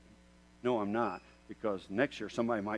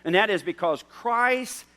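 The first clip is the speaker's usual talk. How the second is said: high, loud impassioned preaching; fiery pulpit appeal; preaching at full force; 9 semitones higher, 12 dB louder, slower than usual. Acted. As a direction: drive each question home. Preaching climax.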